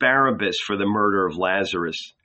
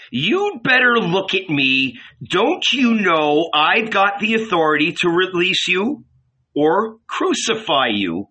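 higher-pitched, indignant challenge; angry demand; 7 semitones higher than usual. A narrator's reading. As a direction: pull rank on a silent prisoner. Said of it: "Pilate became angry".

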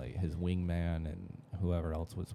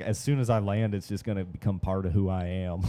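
mocking friend; 8 dB louder than usual. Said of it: "she was like".

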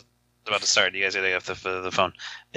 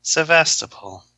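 robot lady voice; 6 semitones higher, slower than usual. Retold or imitated. Repeating robot pronunciation joke.

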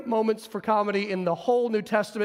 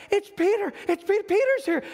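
excited shout; 10 semitones higher than usual. Acted. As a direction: cry out the news breathlessly. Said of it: "she's so excited".

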